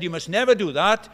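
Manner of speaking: teacher laying down the law, loud and firm; loud stern rule. Retold or imitated. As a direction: bark the rule at the class. Teacher repeating his rule.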